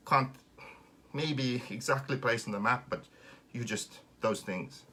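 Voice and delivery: usual speaking voice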